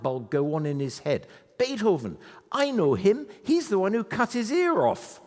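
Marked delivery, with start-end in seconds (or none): 1.6-5.2 higher and louder, lit up with discovery; a sudden eureka from the cabbie; mild imitation of a pleased Scottish taxi driver; excited realization.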